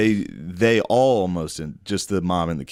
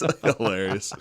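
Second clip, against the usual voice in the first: ordinary talk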